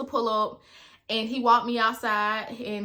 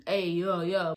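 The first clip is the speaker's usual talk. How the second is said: put-on lower, boyish "hey yo"; talking like a boy; 4 semitones lower than usual. Ex imitating his boyish voice, lower and lightly done. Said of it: "he talked like a boy".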